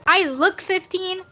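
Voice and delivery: normal talking voice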